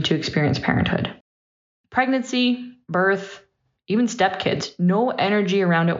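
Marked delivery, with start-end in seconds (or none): none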